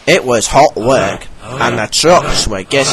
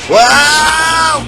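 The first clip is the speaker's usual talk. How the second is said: much higher yelp; cry; 12 semitones higher, 4 dB louder than usual. A narrator's reading. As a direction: cry out while rolling. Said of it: "he cried".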